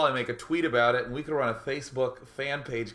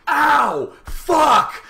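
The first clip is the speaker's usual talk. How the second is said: much higher, loud yelp of pain; pained yell; 14 dB louder than usual. Retold or imitated. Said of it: "I'm just like".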